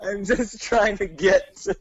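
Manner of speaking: high, slow mock plea; pleading old man. Co-host voicing the driver on his way to work, high slow plea.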